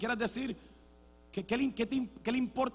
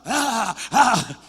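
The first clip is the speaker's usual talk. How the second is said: haka grunts; 3 semitones higher, 10 dB louder than usual. Retold or imitated. Imitating haka faces and cries.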